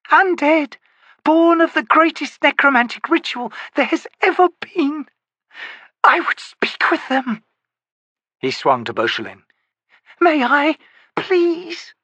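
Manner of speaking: high, reedy, eager voice; a high, thin, pleading voice; eager Broach. Acted. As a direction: plead to speak with the undead.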